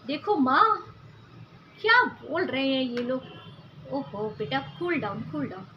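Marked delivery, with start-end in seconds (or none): 3.9-5.7 soothing hush. Acted. Mother calming upset daughter.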